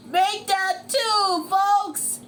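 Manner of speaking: high, loud, stretched announcer call; race announcer. Acted. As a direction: proclaim it over the crowd. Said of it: "said the announcer".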